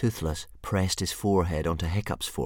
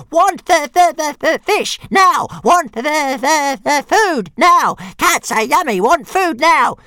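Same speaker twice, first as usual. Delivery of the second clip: much higher, louder, slow screeching demand; shrieking dragon tantrum; 13 dB louder, slower than usual. Acted. Struggling baby dragon shouting at Hiccup for food, shrieking. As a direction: scream for food like a furious hatchling.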